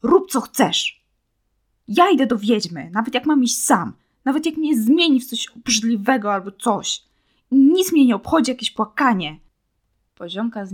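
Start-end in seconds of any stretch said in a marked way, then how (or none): 0.0-9.4 boiling anger. A narrator's reading. Boy angrily tells his friend he goes to the witch alone, loud fury. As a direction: spit it out, furious and done with her. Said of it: "aż gotował się ze złości".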